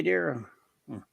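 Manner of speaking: usual speaking voice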